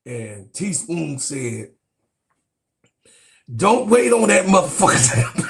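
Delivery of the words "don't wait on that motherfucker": retold coach yell.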